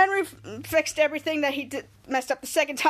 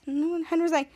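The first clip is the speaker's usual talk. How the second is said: ordinary talk, nothing marked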